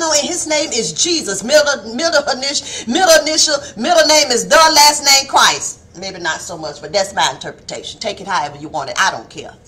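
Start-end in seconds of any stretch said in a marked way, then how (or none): 6.0-9.5 lower, softer offhand remark; quiet shrugging aside; low throwaway aside. Real, not acted. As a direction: toss it off under your breath.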